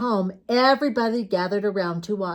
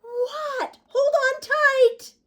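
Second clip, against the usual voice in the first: very high squeaky panic; Froggy frightened as Dad lets go; panicked; slower than usual. Acted. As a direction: squeal it as the bike wobbles.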